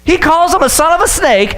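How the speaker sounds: ordinary delivery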